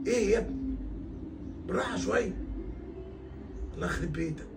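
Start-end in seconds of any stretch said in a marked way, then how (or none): none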